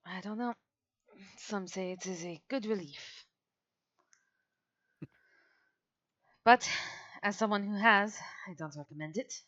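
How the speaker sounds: a hint of a weary captain, musing; groggy, wry captain. Captain answering crewmate about dying, lightly groggy and wry.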